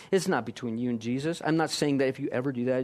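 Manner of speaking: ordinary delivery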